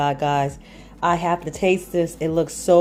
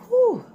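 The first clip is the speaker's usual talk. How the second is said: high whew. Real, not acted. Reaction to hot bite.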